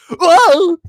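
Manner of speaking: loud, high mock commentator yell; Gary Neville. Fan mimics commentator as shot rolls past post, mock-astonished yell. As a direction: burst out with a high "whoa". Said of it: "and Neville goes".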